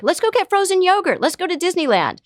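high, bright mock-cheery parent distracting a kid; mock forced cheer. Parent brushing off a sad kid, mock cheery. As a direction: overdo the cheer to dodge the sadness. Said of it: "wants to cheer up the kid".

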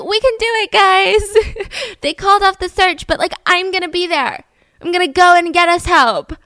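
high, loud mock-heroic rallying; rally them in a high, hammed-up voice; show of courage. Rallying the guys after the search is called off, mock-brave voice. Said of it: "show courage".

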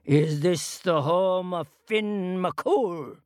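slow, booming giant's question; thundering giant. A narrator's reading. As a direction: boom it out like a giant at the door. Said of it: "he thundered".

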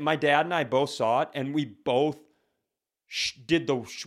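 ordinary talk, nothing marked

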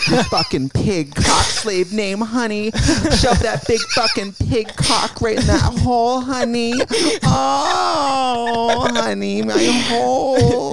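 high, drawn-out camp wailing; hammed-up bawdy drag character. Riffing on the pig slave name, hammed-up camp moaning. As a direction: stretch it out high, mock ecstatic.

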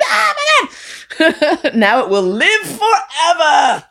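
very high, drawn-out hammed-up wail; mock horrified wail. Teller found out by a coworker, wailing in exaggerated horror. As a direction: wail it slowly in comic despair.

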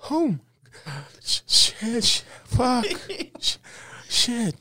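high, choked swearing after blowing it; devastated. Retold or imitated. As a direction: stammer curses in a high, panicked squeak.

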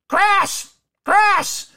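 very high squawking call; imitated screechy parrot shout; bad-voiced parrot. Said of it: "sounded like a, like a, a parrot that had a bad voice".

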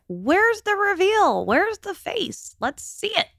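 mock clamoring fans in a very high voice; impatient fans. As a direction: imitate followers demanding, drawn out and high-pitched. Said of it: "so many of you are like".

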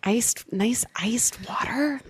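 breathy slow whisper of craving. Pretend. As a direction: whisper it slowly like a treat. Craving iced water, whispered.

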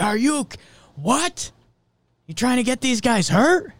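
high-pitched indignant heckler; mock outrage. Imagined objector protesting the push-up punishment, mock outrage. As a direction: pitch it way up, as if appalled on someone's behalf.

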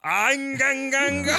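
high shouted hype chant; hype radio voice. Pretend. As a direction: yell it like a hype man. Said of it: "Radio voice".

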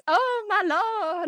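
high, drawn-out Southern Belle lament. Shown as a demonstration. Parent hurrying a child out the door, Southern Belle voice. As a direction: play a melodramatic Southern Belle, slow and high. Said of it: "like, Southern Belle voice".

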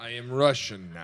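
low, soft mock Russian accent. Captive demonstrates the new accent, low Russian accent. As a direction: intone it in a put-on Russian voice. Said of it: "I gotta catch the accent".

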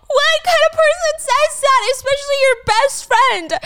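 high, loud, drawn-out outrage; outraged exclaim. Real, not acted. A recapper crying out in outrage at the best friend, high and loud. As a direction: cry out in disbelief at the betrayal.

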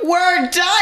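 high, smug status boast; sassy comeback. Retold or imitated. Friends mocking the rude man's silver status, sassy high boast. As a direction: toss it back like a trump card.

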